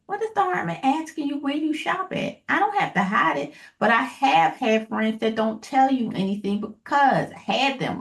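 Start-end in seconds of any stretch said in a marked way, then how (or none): none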